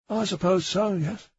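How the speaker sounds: a shifty junior officer pleading ignorance; flustered constable. A narrator's reading. Bang voiced by narrator.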